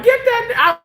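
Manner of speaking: squeaky high mock sneer; hostile patient. Retelling the patient's insult to the doctor, high mock sneer. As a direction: mimic the patient's disgust, very high. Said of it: "then she's like".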